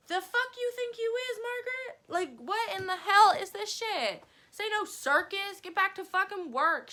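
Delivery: high, loud, outraged telling-off; indignant outburst. Retold or imitated. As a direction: snap at her like she's out of line. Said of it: "And I'm like".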